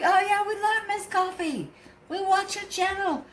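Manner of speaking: high-pitched fan imitation; gushing fans. Fans telling him they love the channel, high gushing imitation. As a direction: gush in a put-on high voice.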